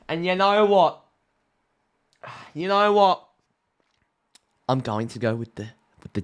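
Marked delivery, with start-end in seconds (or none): 0.1-3.3 excited buildup. Real, not acted. Building to a bold upset pick.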